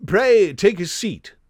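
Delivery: high-pitched, very English host; slow, high and loud English politeness; Sherlock Holmes. Acted. Detective offering a visitor a seat, high-pitched English voice. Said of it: "his high-pitched, very English voice".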